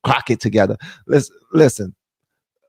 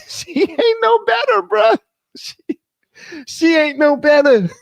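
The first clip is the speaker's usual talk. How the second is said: high and squealing through laughter; can barely get it out for laughing; laughing disbelief. Real, not acted. Telling viewers the new host is no better, laughing high.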